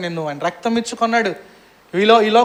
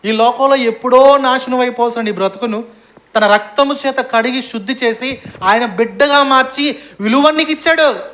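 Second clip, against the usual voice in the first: fervent preaching; 4 semitones higher, 5 dB louder than usual. Acted. Preaching at full force.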